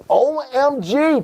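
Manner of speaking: high, drawn-out exclamation; playfully scandalized, stretching each letter; slow, high-pitched mock outrage; mock shock. Mock shock at Tom yelling.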